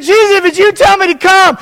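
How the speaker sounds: high acted call to Jesus. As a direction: call across the water as the disciple. Acting Peter calling to Jesus.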